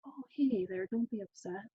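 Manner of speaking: quiet, hushed "calm down" voice; mock soothing someone who is angry; soft dismissive soothing. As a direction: gently brush off the anger. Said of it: "someone else says".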